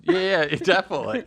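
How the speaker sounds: high, loud words through laughter; laughing. Real, not acted. Host reacting to baby daddy joke, laughing high and loud. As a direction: talk while still laughing.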